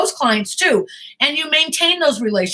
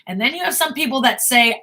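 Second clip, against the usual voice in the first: usual speaking voice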